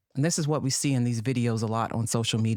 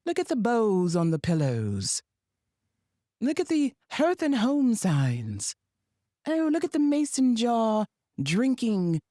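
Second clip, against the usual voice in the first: put-on high, sugary Southern plantation-hostess accent, pitched well up; mock Southern hostess accent; 8 semitones higher than usual. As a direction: sell the remodeled slave quarters like a proud Southern host. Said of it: "I don't know what that accent is".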